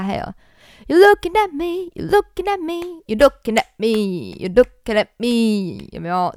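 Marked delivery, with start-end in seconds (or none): 0.9-5.9 sung high with syncopated push on "looking"; syncopated jazz phrasing; syncopated accents. Shown as a demonstration. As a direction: snap each "you're looking at me" ahead of the beat. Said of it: "切分音的感觉".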